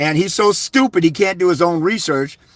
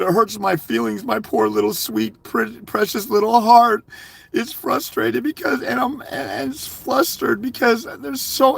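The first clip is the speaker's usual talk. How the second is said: mock whiny crybaby; 6 semitones higher than usual. Mocking guys who whine they can't win, hammed-up crybaby whine. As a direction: whine like a helpless crybaby with hurt feelings. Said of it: "crybaby, whining, crying babies".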